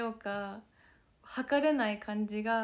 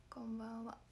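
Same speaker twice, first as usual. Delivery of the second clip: usual speaking voice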